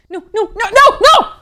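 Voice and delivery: loud high pleading no; desperate shout. Real, not acted. Gamer yelling at the screen in panic. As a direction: yell no over and over in panic.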